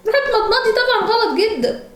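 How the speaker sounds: high, half-laughing mom scolding; exasperated mom scolding. Real, not acted. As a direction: scold her in shock, laughing. Daughter ate lip balm.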